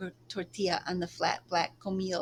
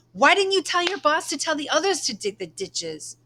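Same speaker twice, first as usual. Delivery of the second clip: furious outburst, much higher and louder; anger bursting out; 8 semitones higher, 7 dB louder than usual. Acted. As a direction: let the rage explode at him. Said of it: "her anger burst forth".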